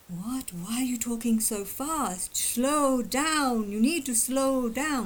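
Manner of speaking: mock scolding to slow down; mocking slow talker. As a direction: play the person telling him to slow down, drawn out and exasperated. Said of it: "they might look at people who talk like me as just being like".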